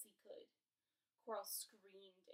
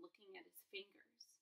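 ordinary delivery

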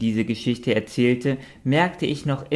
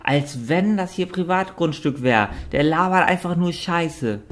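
wütend quakend; 7 semitones higher than usual. A narrator's reading. Richard redet sich in Rage.

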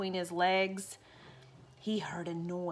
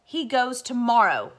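louder final verdict; firm father; 3 semitones higher, 8 dB louder than usual. Acted. Father refusing the children, firm and louder. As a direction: shut the argument down. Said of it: "said Father".

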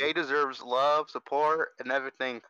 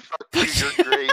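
high, loud gruff praise; aggressive compliment; 13 semitones higher, 6 dB louder than usual. Retold or imitated. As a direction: shout the compliment at them. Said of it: "Jules said".

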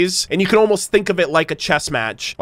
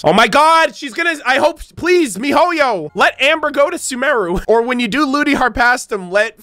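excited plea; 6 semitones higher than usual. Real, not acted. Begging the developers excitedly.

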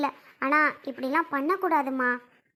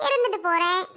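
shrugging "இருந்துட்டு போறேன்" near usual pitch; mild defiance. Acted. Scolded woman accepts her bad name, mildly defiant. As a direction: toss it off without raising the pitch. First take of defiant retort.

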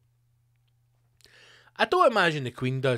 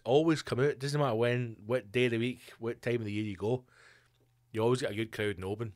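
normal talking voice

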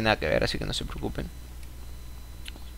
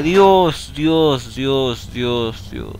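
fading exasperation; much slower than usual. Real, not acted. Exhausted after panic.

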